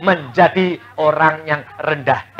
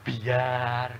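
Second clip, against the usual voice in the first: soft resigned; 5 semitones lower, 11 dB quieter than usual. Retold or imitated.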